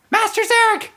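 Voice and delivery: the attendant squeaks "Master Zarek!" very high. Acted. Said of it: "speaking two octaves higher".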